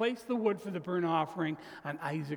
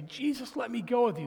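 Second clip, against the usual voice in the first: pleading; 7 semitones higher, 3 dB louder than usual. Acted. Healed demoniac pleads.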